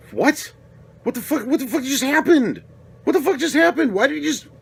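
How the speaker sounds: outraged shock, what just happened; incredulous outrage. Retold or imitated. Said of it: "and I'm like".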